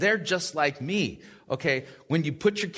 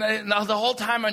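ordinary talk

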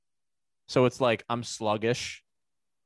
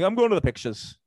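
mock Irish; 7 semitones higher, 4 dB louder than usual.

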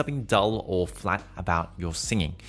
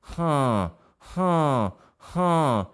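nasal huh; 6 semitones higher, 5 dB louder, much slower than usual. Shown as a demonstration. Coach demonstrating true nasality. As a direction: air through the nose, loud. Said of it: "goes up into your nose".

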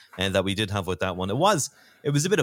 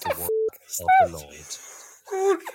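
priest voice; 3 dB louder than usual. Acted. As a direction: intone it like a priest at a funeral. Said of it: "Did you like my priest voice?".

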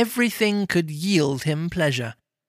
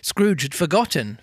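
ordinary delivery

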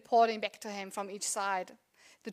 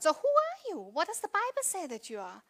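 animated questioning mum; 7 semitones higher than usual. Retold or imitated. Mum asks daughter, pitch jump.

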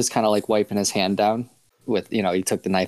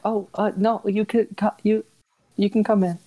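much higher, stammering voice; flustered welcome; 13 semitones higher than usual. Acted. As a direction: trip over words hurrying to invite them in. Flustered shopkeeper calls guests back.

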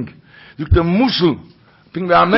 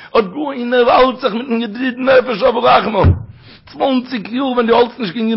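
fervent preaching; 6 semitones higher than usual. Acted. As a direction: preach it out to the whole room. Chanting the rabbi's message.

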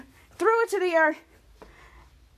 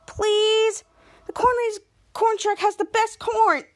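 higher, wheedling plea; higher coaxing plea; pleading; 7 semitones higher than usual. Acted. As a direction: beg sweetly for the corn. Mika begging Schwarz.

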